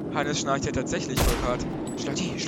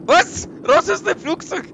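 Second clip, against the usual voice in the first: sudden loud panicked yell, pitched up high; panicked shout; 13 semitones higher, 11 dB louder, slower than usual. Acted. Attendant screaming at infected passenger to get out, panicked shout. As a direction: scream them off the plane in mock terror.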